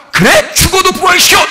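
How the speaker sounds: high, loud indignant challenge; believer's bold shouted retort; defiant shout. Acted. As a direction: fire it back at the devil. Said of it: "이렇게 딱 나와야 하거든요".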